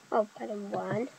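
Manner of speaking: ordinary talk, nothing marked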